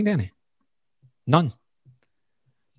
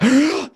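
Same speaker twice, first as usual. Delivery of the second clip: sharp high gasp, reenacting waking from sleep apnea; gasping for air; 6 dB louder than usual. Man retelling a night of sleep apnea to his host, sharp gasp. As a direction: suck in air like you can't breathe. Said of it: "trying to catch a breath".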